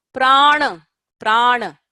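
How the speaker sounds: Prana said with a clear rolled r and long open a; careful Sanskrit "prana", the first a held long; correct pronunciation. Shown as a demonstration. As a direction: model the word "prana" slowly for students. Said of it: "please don't say prana".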